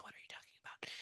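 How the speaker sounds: barely audible whisper with no voice. Real, not acted. Medium whispering puzzled to the spirit. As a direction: breathe the question out in private puzzlement.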